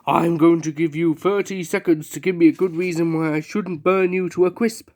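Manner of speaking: usual speaking voice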